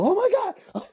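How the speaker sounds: high-pitched imitation of panicking parents; quick burst of pretend alarm; mock freak-out. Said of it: "freak out".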